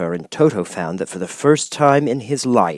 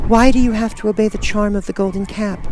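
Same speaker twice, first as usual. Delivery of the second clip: put-on high girl's question; curious young girl; 14 semitones higher, 3 dB louder than usual. A narrator's reading. Girl curious about the charm, high voice. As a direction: ask it wide-eyed as the girl. Girl asks monkey king.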